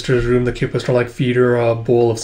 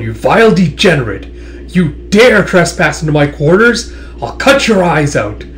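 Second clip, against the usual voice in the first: raised, furious threat; acted outrage; 4 semitones higher, 4 dB louder than usual. Warlord rushing at the intruder in the quarters, outraged. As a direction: play an enraged warlord charging in.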